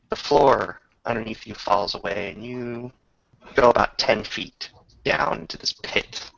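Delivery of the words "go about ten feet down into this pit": usual speaking voice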